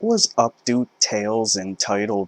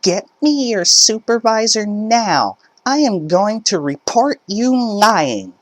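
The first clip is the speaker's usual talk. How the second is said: much higher, louder scream; screaming mother; 13 semitones higher, 5 dB louder than usual. Acted. Mother screaming for the supervisor, high loud scream. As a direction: yell right in his face. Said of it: "press her finger into my chest and scream".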